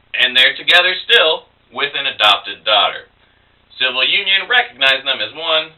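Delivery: lilting verse reading that starts high and settles; measured, singsong poem reading; nursery-rhyme recital. Acted. Poet reads rhyme parody.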